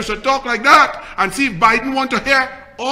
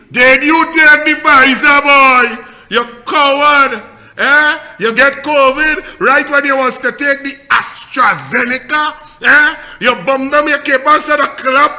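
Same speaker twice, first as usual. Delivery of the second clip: shouted, confrontational goading; loud taunting challenge; 3 semitones higher, 11 dB louder than usual. Real, not acted. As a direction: shout him down like a coward.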